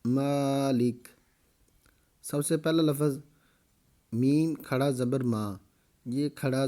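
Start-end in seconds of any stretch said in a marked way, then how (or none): 0.0-1.1 مالک read slowly, final ک stopped with no vowel; drawn-out مالک with a silent stop on ک; pausal stop reading. Shown as a demonstration. Teacher shows students how to stop on مالک, slow and clear. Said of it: "آگے وقف".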